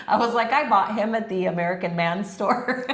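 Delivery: ordinary delivery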